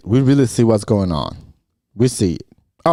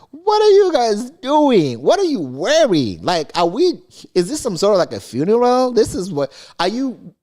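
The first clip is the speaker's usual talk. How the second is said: incredulous; 8 semitones higher than usual. Retold or imitated. Host mocking the launch outfits, high incredulous whine. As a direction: ham up shocked disbelief, pitched high. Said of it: "I'm like".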